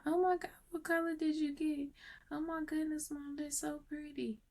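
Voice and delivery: put-on high, soft little-boy voice, gushing. Boy admiring his mom's fresh nails, gushing softly. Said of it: "it was just the way you said it".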